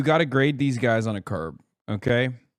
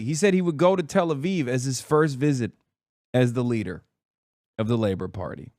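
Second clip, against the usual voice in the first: normal talking voice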